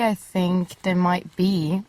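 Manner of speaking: usual speaking voice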